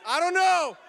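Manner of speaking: playful parroting of a student; mimicking student. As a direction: echo the kid's clueless answer. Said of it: "She said".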